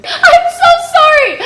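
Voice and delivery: high, loud apology through real laughter; laughing apology. Real, not acted. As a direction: apologize while cracking up. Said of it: "I'm laughing so hard".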